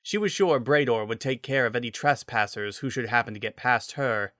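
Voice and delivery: ordinary delivery, nothing marked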